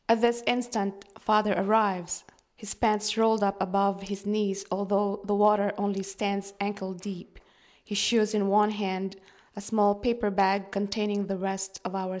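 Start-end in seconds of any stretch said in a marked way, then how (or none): none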